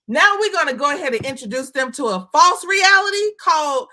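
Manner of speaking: incredulous exclaim. Real, not acted. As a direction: build up to an incredulous shout. Exclaiming about metaverse.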